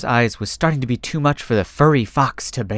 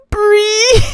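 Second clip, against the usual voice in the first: very high, loud squeal; a silly shriek for the camera; photo squeal; 7 dB louder than usual. Acted. Trio posing for a photo, very high shriek.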